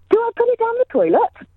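very high mock child's shout, no real volume; high-pitched imitation of her daughter calling out; imitating loud child. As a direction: pipe up like a small child in public. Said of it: "she shouts quite loudly".

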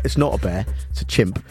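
ordinary talk, nothing marked

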